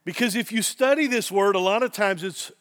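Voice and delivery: ordinary delivery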